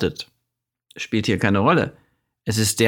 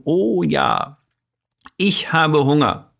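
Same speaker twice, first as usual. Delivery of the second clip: put-on higher, brighter child's voice; eager toddler; 5 semitones higher, slower than usual. Toddler repeats the corrected sentence to her father, higher and eager. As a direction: play the little girl proudly repeating dad. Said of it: "dann wirst du ganz schnell sagen".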